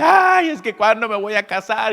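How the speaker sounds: high, loud lament of a would-be bride; mock plaintive sigh of longing; whiny single person. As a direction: moan it like someone desperate to marry. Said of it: "cuando alguien dice".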